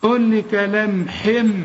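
كلمحم with the tanween turned into a clear m; ending in m. Shown as a demonstration. Teacher showing the iqlab of the tanween. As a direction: say كلمحم, tanween becoming a closed-lip m. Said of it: "بدل ما تقول لي كلمح".

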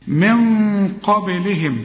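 من قبلهم drawn out slowly, qaf full; heavy qaf. Shown as a demonstration. Teacher shows emphasis before the qaf. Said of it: "الحرف اللي يجي بعده حرف مفخم".

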